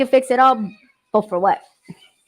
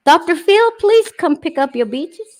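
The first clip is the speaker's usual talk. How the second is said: mock call-out; 6 semitones higher, 4 dB louder than usual.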